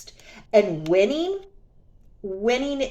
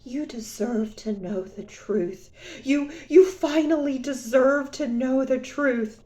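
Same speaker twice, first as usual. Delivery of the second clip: lightly voiced smug ex; mock ex; 4 semitones higher than usual. Quoting the ex's confession, a hint of mock gravity. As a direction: say it with a touch of mock solemnity. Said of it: "This is what he said".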